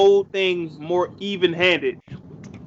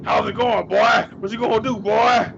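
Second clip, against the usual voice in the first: higher-pitched taunting wrestler impression; imitated tough-guy trash talk, pitched up; Biker Undertaker; 6 semitones higher than usual. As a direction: goad a downed opponent in a put-on voice. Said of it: "his old voice saying".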